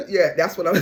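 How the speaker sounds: loud, slowed, laughing emphasis; excited laughing shout. Real, not acted. Reader telling viewers what the cards show, laughing and loud. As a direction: shout it through the giggles.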